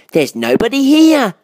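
high, loud little-bear voice; high child-like character; Baby Bear. A narrator's reading. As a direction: call it out like a small cub. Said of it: "said Baby Bear".

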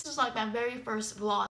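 ordinary talk

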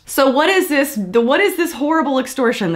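high, pushed question in disbelief; incredulous question. Real, not acted. Vlogger questioning the dad's extortion claim, incredulous. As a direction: demand an answer, baffled.

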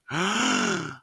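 high, sharp intake of breath; shocked gasp. A narrator's reading. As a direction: gasp at the sight. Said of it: "Me and Mama both went".

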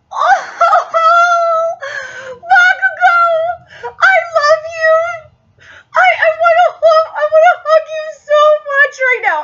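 high, loud, tearful cries of love; crying fangirl shout. Real, not acted. Fan crying out to Bakugo, loud and tearful. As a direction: wail it out like a sobbing fan. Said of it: "I'm trying not to cry".

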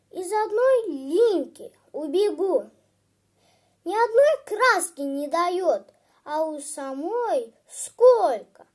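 put-on loud, indignant childish complaint; aggrieved boy. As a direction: complain hotly about an unfair sister. Boy complains about his sister.

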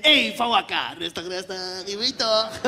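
laid-back Surinamese Dutch, easy and loose; a chill Surinamese coach calming a player down; relaxed Surinamese-accented coach. Retold or imitated. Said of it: "een Surinaamse bondscoach willen hebben".

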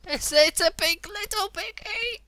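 very high, loud, drawn-out squeal; excited squeal. Real, not acted. Gamer confirming it's a piggy, ecstatic squeal. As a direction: squeal it slowly with delight. Squeals over a cute pig.

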